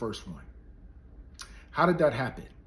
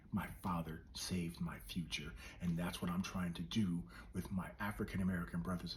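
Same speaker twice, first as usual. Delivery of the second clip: intimate whispered appeal; 6 semitones lower, 13 dB quieter than usual. Real, not acted. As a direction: lean in and plead softly.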